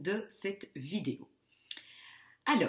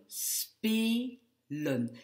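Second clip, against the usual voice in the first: spelen as spe, pause, len; cut after the E; 5 semitones higher than usual. Shown as a demonstration. Teacher showing the lost vowel, spelen syllable by syllable. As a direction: slowly separate spelen after its long E. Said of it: "Je vais découper après le E".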